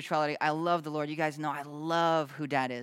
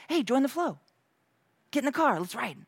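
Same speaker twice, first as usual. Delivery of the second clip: higher, bright call to hop in; eager invitation; 8 semitones higher than usual. Retold or imitated. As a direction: invite them along like a friend at the wheel. God inviting us, voiced.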